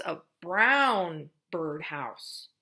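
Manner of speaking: each word drawn out slowly; slow modeled phrase. Shown as a demonstration. Therapist modeling a phrase for a child, very slow. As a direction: stretch every word so a child can copy. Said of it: "you could say".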